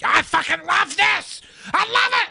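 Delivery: high, slow, overexcited yell; mock gushing shout. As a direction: shout it like a thrilled fan. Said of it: "and I'll be like".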